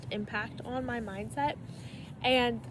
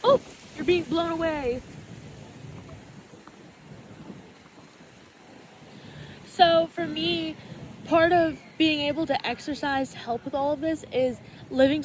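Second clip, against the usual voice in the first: raised voice against the wind; shouting over wind; 4 semitones higher, 3 dB louder than usual. Real, not acted. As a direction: speak up over gusts of wind. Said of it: "hope you can hear me over the wind".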